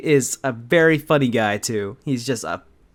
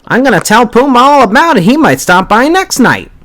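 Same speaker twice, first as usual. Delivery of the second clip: loud mock taunt; 10 semitones higher, 9 dB louder than usual. Teasing mock threat.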